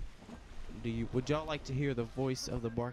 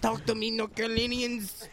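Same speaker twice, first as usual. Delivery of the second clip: hype rapper voice; 10 semitones higher than usual. Retold or imitated. Imitating rap call-out.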